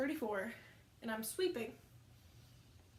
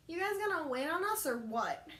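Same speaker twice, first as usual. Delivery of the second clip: higher-pitched mock demand; impatient customer; 5 semitones higher than usual. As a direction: imitate a customer demanding service.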